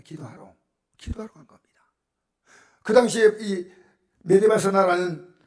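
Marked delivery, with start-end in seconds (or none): 0.0-1.8 "기도하러 간 겁니다" whispered, much softer, falling at the end; whispered repeat. Shown as a demonstration. Preacher softly repeats the point to the congregation in a whisper. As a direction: hush it, so "기도하러" lands close and soft.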